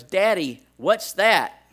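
put-on high, loud little girl question; child's voice. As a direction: call out like a curious toddler. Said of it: "and she goes".

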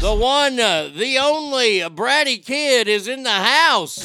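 loud, high announcer-style build; hype introduction. Real, not acted. Host announcing the bratty kid's arrival, hyped introduction. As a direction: proclaim each phrase big, with pauses between.

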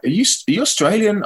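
high, puzzled question; curious questioner. Retold or imitated. People asking the London singer where he's from, curious questioner. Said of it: "they're like".

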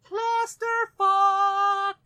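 loud, very slow drawn yell; shouting. Pretend. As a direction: stretch it out in a yell. Yelled sign-off.

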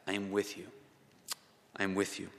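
soft, low, hushed promise; tender reassurance. Pretend. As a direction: breathe it gently, like a comfort to someone afraid. Voicing God's promise.

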